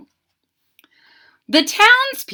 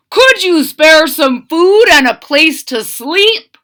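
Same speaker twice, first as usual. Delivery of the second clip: louder, higher plea at the door; soldiers asking loudly; 6 semitones higher, 9 dB louder than usual. A narrator's reading. Soldiers begging villagers at the door, louder and higher. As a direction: call out to be let in. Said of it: "they asked".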